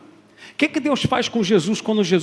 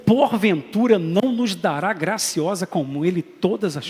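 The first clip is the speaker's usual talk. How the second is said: slow, loud, high reading of scripture; solemn scripture reading; 4 semitones higher, 5 dB louder, slower than usual. Acted. Pastor reading Romans aloud to the church. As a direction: declaim the verse.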